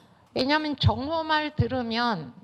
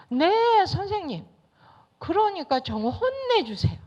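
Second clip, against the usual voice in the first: put-on eager child; 7 semitones higher than usual. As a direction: pester the teacher like a kid who wants revenge. Child demanding teacher punish. (선생님께 조르는 아이 목소리, 높고 크게)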